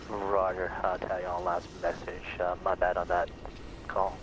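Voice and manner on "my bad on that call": ordinary talk, nothing marked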